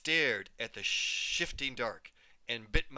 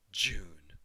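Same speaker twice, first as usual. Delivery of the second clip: tender whisper; 7 semitones lower than usual. Acted. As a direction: breathe the name like a caress. Mother's tender last word.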